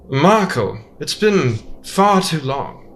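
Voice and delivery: a lightly voiced Min, sly and slow; Min's greeting. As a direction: greet an old accomplice with menace.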